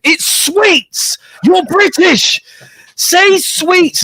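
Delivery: very high squeal of mock outrage; mock indignation. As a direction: squawk a scandalised correction, pitch way up. Friends indignantly correct her American word.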